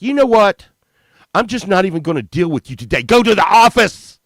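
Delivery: put-on high, loud furious teacher snapping at a kid; shrill-free high shout of a woman done with you; angry yell. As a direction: yell it like a fed-up teacher throwing him out. Principal's wife yelling at kid.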